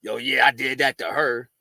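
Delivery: low-pitched mock boasting; mock braggart's low voice; imitated braggers. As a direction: drop the pitch to mimic men bragging.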